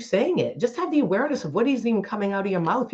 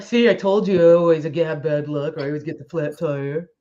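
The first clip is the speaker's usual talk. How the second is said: a put-upon voice, sure luck is against them; mock self-pitying grumble; whiny complainer; 4 semitones lower, 5 dB louder than usual. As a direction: moan like someone who always gets the flat tire. Person blaming bad luck.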